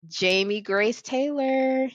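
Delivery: high, slow, drawn-out name call; grand announcement. Real, not acted. Host announcing her guest to the audience, grandly.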